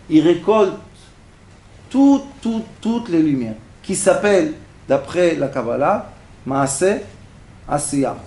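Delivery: normal talking voice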